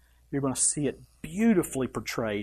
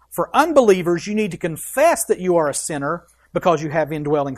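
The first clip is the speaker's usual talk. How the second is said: rising, louder preaching voice, pressing each phrase; full-force preaching; 4 semitones higher, 5 dB louder than usual. Acted. Pastor preaching forgiveness to the congregation, full force. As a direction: preach it out, building to the cross.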